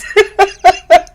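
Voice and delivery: squealing high-pitched laugh. Real, not acted. Cracking up at the lady.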